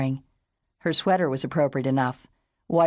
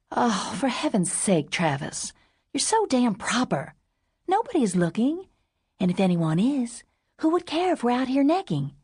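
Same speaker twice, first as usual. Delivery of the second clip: put-on higher, exasperated woman, scolding her proper boyfriend; exasperated teasing; 7 semitones higher than usual. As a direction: tease him for being so proper, rising in exasperation. Sage exasperated with proper Travis.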